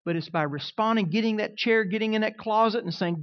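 ordinary delivery

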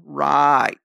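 low, drawn-out doubtful "right"; skeptical mock agreement. Peter told to find money in a fish, skeptical. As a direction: play Peter not buying it, drawing the word out low.

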